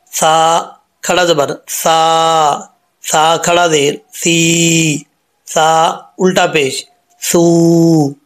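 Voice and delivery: very slow, each syllable drawn out; slow recitation drill. Shown as a demonstration. Teacher drilling vowel marks with students, slow recitation. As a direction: spell it out slowly for the class. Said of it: "ये इसका sound है".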